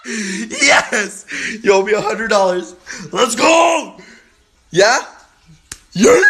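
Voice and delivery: high, loud victory whoop; fast gloating cheer; triumphant shouting. Real, not acted. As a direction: crow over winning the bet. Wins bet, celebrates.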